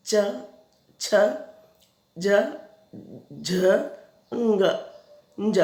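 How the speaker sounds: च, छ, ज, झ said one by one with long pauses; sounding out consonants. Shown as a demonstration. Teacher showing pupils how palatal letters sound, each separate. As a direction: recite च छ ज झ crisply, letter by letter. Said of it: "आवाज़ निकलती है".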